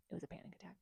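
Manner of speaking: hushed confession to the viewers; whispered admission. Real, not acted. Vlogger admitting the truth to viewers in a whisper. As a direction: let it out in a whisper, conceding the point. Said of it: "dramatic whispered admission aside".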